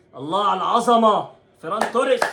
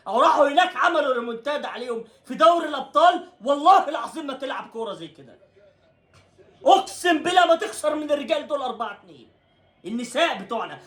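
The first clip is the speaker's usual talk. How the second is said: much higher, shouted outrage with laughs; heated rant; 9 semitones higher than usual. Real, not acted. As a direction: shout it out in disbelief. Ranting about women's team. (صراخ غاضب ساخر عن الفريق)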